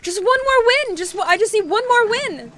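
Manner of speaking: high, loud gambler's plea; frantic, shouted pleading; desperate yelling. Real, not acted. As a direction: beg loudly for a lucky break. Desperate for a win while gambling.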